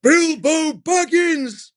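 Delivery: slow, high wizardly proclamation; Gandalf voice. Retold or imitated. Gandalf addressing Bilbo, slow grand wizard call. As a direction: call the name out slowly like a wizard. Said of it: "when Gandalf says".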